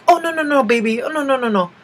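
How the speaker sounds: high, sweet refusal of an offer; high-pitched, quick string of gentle nos; kindly elder declining. Retold or imitated. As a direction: imitate a polite older person waving off the seat. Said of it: "most of them will be like".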